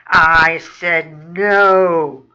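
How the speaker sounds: slow, loud snarl. Acted. Boss rat refuses his whining pal, snarling. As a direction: drag out each word as a threat. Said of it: "bares his yellow teats and snarls".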